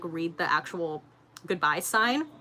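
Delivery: ordinary delivery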